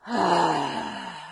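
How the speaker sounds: a long, loud sighing exhale; audible sigh. Shown as a demonstration. Tapping coach opening with a loud sigh. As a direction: let the breath out with a sound. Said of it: "Exhale with a sigh".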